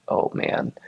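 whispered dread under the breath; a whispered, sinking oh man; under-breath groan. Retold or imitated. Put on the spot by the preacher, whispered dread.

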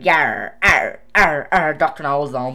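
loud pirate arrs. Acted. Quizmaster giving the answer to say to a pirate, loud pirate. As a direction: call it out in pirate talk. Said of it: "So you say to a pirate".

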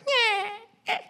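high thin mock wail of an infant; squeaky newborn cry impression; imitated baby cry. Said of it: "baby Taya cry".

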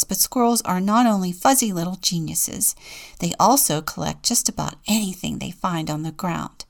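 plain reading voice

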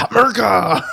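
loud proud yell; patriotic shout. Pretend. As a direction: bellow the word like a chant. Bullet shouts its country.

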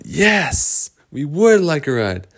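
high, drawn-out delighted yes; eager. Acted. Boys eagerly accept a ride.

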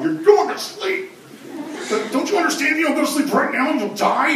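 hammed-up parent losing patience; mock exasperated parent. Parent telling stalling kid to sleep, mock stern.

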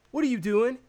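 high, loud questioning voice; the boss catches them out; mock boss asking. Boss asks the supervisor what he is doing, high and loud. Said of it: "she's like".

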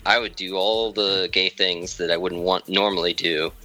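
normal talking voice

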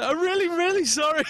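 put-on very high, overdone drunken sorry; drunk apology. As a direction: plead sorry like a babbling drunk.

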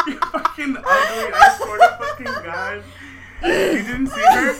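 high squeaky laughter; laughing hard. Real, not acted. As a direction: crack up while talking. Friends cracking up on mic.

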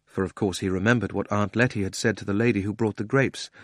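ordinary delivery, nothing marked

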